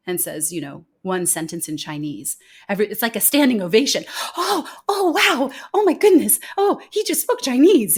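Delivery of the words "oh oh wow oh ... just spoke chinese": high, loud over-the-top gushing, mocking the crowd; mock gushing praise. Audience gushing at a CEO's one Chinese sentence, loud and high.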